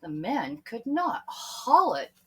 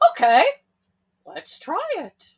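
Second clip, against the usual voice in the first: agreeing; 8 semitones higher, 9 dB louder than usual. Acted. Peter agrees.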